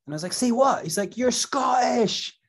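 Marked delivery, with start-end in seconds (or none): 0.3-0.8 a sharp jump of surprise; bristling, taken aback; retold shocked question. Shocked rapper asks exec. 1.2-2.3 scornful record exec, dragged out; mocking put-down. Label boss mocks their accent.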